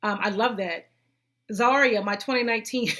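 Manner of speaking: ordinary delivery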